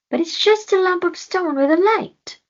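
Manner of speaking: high, loud mocking voice; panther scoffing. Panther mocks Floki's stone, high loud scoff.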